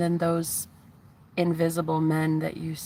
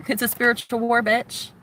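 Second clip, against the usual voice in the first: higher, punchy and defiant; a higher-pitched, defiant battle cry; fierce declaration; 5 semitones higher than usual. Real, not acted. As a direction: throw down the gauntlet.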